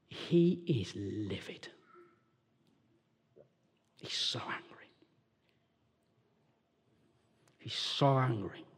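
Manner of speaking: "he is livid" whispered, barely voiced; a hushed, slow hint of seething. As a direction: drop to a slow near-whisper. Hushed telling of brother's anger.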